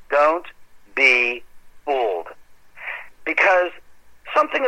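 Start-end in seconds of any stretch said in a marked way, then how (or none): 0.1-2.3 Don't, be, fooled each set apart by pauses; slow emphatic warning. Shown as a demonstration. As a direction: space the words out to drive the warning home. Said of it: "advice to listeners, word by word".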